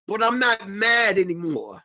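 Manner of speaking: ordinary delivery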